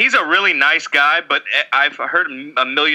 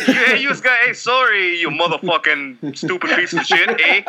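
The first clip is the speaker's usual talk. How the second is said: pitched-up jokey Canadian, polite then cursing; mock Canadian accent; 2 semitones higher than usual. Mock Canadian voice swearing at his own teammates. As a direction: play an apologetic Canadian who suddenly swears. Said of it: "I always throw Canadian into all my accents".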